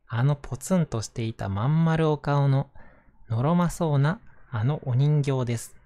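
gentle storybook narration voice; reading to children. Acted. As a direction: read it aloud to little kids. Reads a picture book aloud.

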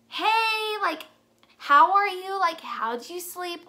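imitating someone acting totally normal; breezy, as if nothing happened. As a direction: mimic a carefree morning-after phone call. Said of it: "everything's back to normal as if nothing happened".

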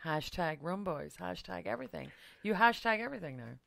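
ordinary delivery

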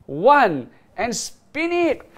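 loud drawn-out spin call; hyped giveaway announcement. Real, not acted. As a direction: stretch out the big moment. Countdown to wheel spin.

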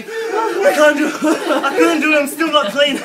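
high, loud mock wailing; hammed-up, defeated wail; despairing wail. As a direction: cry it out in comic despair. Brother despairing after the shower.

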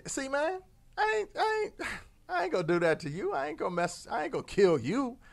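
a hint of an easygoing scorpion with a laugh; lightly voiced scorpion, chuckling. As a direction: shrug it off, high and breezy. Scorpion swearing it's harmless.

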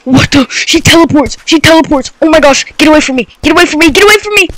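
loud high panicked cries; panicked yelling. Real, not acted. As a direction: yell it in panic as she chases you. Chased by monster.

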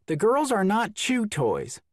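high, exasperated mom voice; exasperated mother. Acted. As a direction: scold like a fed-up mother. Mother scolding the children.

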